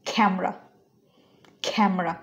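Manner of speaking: "ক্যামেরা" as KHAM-ra, puff on the k; aspirated k. Shown as a demonstration. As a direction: open "ক্যামেরা" with a breathy k, two syllables. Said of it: "আমি বলতেছি".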